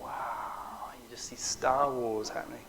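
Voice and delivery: whispered in wonder; awed whisper. Retold or imitated. On the rooftop watching the interceptions, an awed whisper. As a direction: breathe it out, awestruck.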